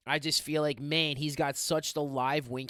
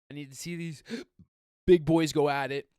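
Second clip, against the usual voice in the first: hiccup catching mid-sentence; real hiccup; 6 dB louder than usual. Real, not acted. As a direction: talk on through a sudden hiccup. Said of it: "getting hiccups".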